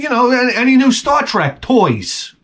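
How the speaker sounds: bait him sweetly; mock innocent tease. Teasing Tom in break room.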